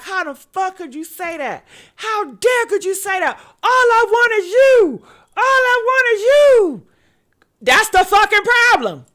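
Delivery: imitating his rage, high and loud; enraged outburst. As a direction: build from indignant to high yelling. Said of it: "He was enraged. He was mad".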